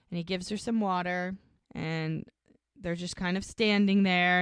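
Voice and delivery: usual speaking voice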